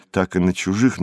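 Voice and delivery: ordinary talk, nothing marked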